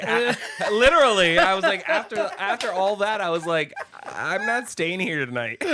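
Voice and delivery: high and loud through laughter; can barely get it out for laughing; cracking up mid-story. Real, not acted. Laughing while telling story.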